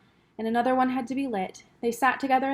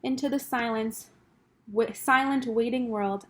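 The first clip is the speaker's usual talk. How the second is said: usual speaking voice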